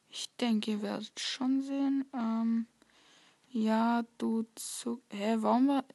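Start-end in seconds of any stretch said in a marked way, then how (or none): none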